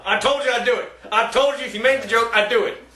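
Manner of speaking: high, insistent told-you-so; indignant scolding. Real, not acted. Co-host who punished a bad joke, high indignant scolding. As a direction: scold them, pitched well up.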